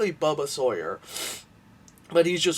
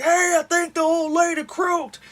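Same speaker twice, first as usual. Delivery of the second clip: much higher, louder startled announcement; mock alarm; 7 dB louder than usual. The teens noticing the old lady died, high loud startled blurt. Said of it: "they're like".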